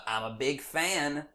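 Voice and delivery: slow, soft Bush impression; George W. Bush impression. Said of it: "George W. Bush is".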